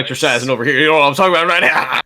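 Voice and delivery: fast excited bro; hyped up. Acted. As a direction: pump it up, fast and loud. Exaggerated exercise enthusiast bit.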